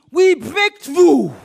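loud, slow, emphatic declaration; forceful proclamation. Real, not acted. Preacher declaring breakthrough to the congregation, forceful. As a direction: proclaim it loud, stretching each word.

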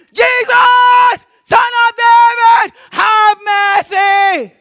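full-force, high, drawn-out shout; screaming plea. Acted. Blind Bartimaeus calling to Jesus for mercy, screaming. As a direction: scream it out to be heard over a crowd. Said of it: "He was screaming, sorry, I shout".

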